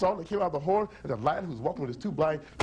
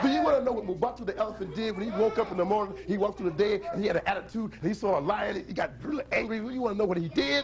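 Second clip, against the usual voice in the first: fast, higher-pitched build-up; hyping up the audience; 5 semitones higher, faster than usual. Real, not acted. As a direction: wind the crowd up to answer. Teases young audience to shout.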